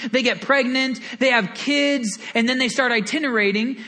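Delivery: ordinary talk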